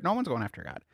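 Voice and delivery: normal talking voice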